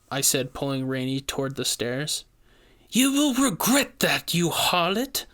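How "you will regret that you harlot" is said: high, shrill-pitched threat; witch screaming. A narrator's reading. Witch screaming at fleeing pair, high threatening cry. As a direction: spit it after them as they flee. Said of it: "the witch screamed".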